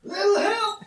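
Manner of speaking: high, laughing call for help; laughing cry for help. Acted. Coach Flaps calling for help while attacked, laughing. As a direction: yell for help through laughter. Character being attacked calls.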